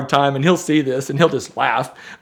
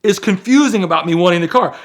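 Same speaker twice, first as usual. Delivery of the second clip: higher and louder, exasperated disbelief; retold frustration, pitched up and pushed louder; frustrated; 6 semitones higher, 5 dB louder than usual. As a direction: demand to know how this could be unclear. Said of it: "I'm getting frustrated".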